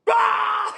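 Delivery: high, loud mock scream; imitated monster scream. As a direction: burst into a sudden high shriek. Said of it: "He just goes".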